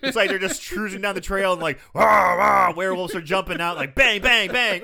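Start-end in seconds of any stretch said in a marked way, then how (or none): 1.9-2.7 loud mock werewolf roar; monster growls. Acting out werewolves attacking. 4.0-4.8 loud mock gunfire, shouted; gunshot noises. Acting out gunfire in cemetery.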